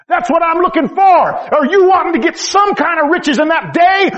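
full-force sermon voice; fiery preaching. Acted. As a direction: preach it out, rising hard.

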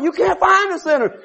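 ordinary delivery

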